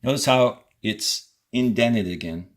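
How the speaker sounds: normal talking voice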